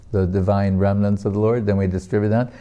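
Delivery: usual speaking voice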